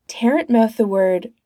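plain reading voice

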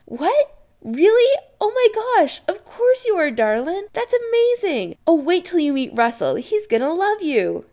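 very high, loud, gushing mother; delighted. Acted. Kim to Adam on learning he's a sorcerer, delighted. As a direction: squeal with surprise and joy.

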